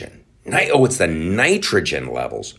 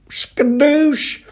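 high cartoon shout; 3 dB louder than usual. Bragging about number one, high cartoon cry. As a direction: squeal it out, high and triumphant.

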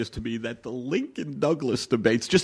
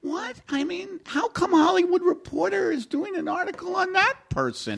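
high, incredulous mock gossip voice; 14 semitones higher than usual. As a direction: squeak it out in disbelief, half-joking. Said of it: "And it's done in that voice".

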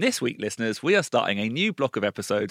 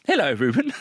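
mock formal hello; 5 semitones higher, 6 dB louder than usual. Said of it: "we would never say hello".

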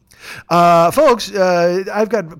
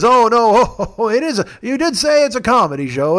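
high, chuckling mock grandpa; hammed-up old-timer's amusement; Officer Irwin; 5 semitones higher, 4 dB louder than usual. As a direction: play a tickled old man, pitched up. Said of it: "like you're a grandpa".

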